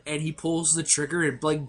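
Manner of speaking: normal talking voice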